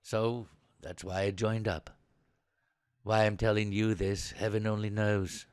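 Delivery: low, soft, reflective admission; subdued confession. Acted. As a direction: confide reluctantly, energy drained. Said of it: "after a deep intake of breath".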